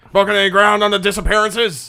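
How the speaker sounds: very loud, high-pitched grilling; shouting police captain. Acted. Captain presses detective on disappearances, exasperated shout. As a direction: yell the question in exasperation. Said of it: "Jackson comes up to you and goes".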